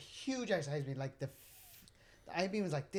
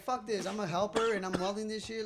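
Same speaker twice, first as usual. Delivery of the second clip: retold anger; 6 semitones higher, 4 dB louder than usual. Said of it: "I was like".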